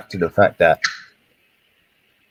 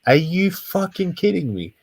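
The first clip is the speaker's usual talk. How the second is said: high and slow, stunned; can't believe what he's hearing; drawn-out high disbelief; amazed disbelief; 8 semitones higher than usual. Real, not acted. Reacts to a surprising point.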